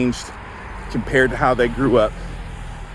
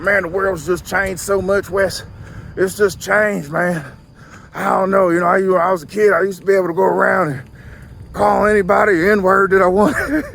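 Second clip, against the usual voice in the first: put-on Southern accent; 6 semitones higher than usual. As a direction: imitate a friend griping in a Southern accent. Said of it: "I'm giving everyone a Southern accent".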